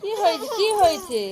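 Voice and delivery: usual speaking voice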